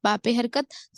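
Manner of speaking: normal talking voice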